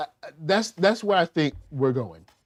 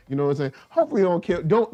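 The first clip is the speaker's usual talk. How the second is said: ordinary talk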